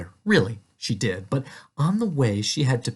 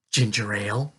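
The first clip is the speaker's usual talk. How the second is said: ordinary delivery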